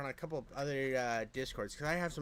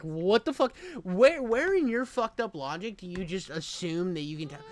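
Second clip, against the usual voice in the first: louder and higher, venting in disbelief; exasperated inner rant; 6 semitones higher, 8 dB louder than usual. Retold or imitated. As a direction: blurt the inner outrage, much louder and higher. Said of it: "In my head".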